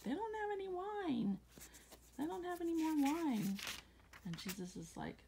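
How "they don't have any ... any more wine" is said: much higher, worried mother voice, wailing the complaint. Retold or imitated.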